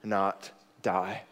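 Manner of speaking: very slow, soft absolution; a pastor's quiet forgiveness; gentle pardon. Retold or imitated. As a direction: grant mercy tenderly. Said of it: "Nathan said".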